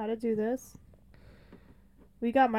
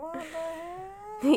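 slow, higher exasperated complaint; 6 semitones higher, much slower than usual. Retold or imitated. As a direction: drag it out in disbelief. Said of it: "I'm like".

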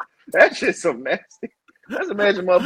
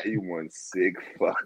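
low, slow, softer quoted voice; imitating some guy; 9 semitones lower, 8 dB quieter than usual. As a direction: drop down into a slow impression. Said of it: "you know that one guy".

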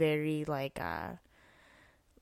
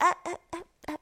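mock stammer; 12 semitones higher, much faster than usual. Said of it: "and I was like".

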